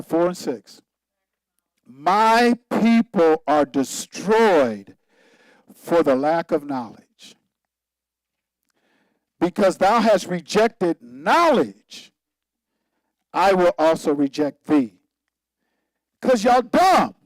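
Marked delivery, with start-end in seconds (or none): 1.9-15.0 slow, loud scripture reading; preaching scripture. Acted. Preacher reads Hosea to his congregation, slow and loud. 16.2-17.1 blunt jab. Real, not acted. Blunt punchline after scripture.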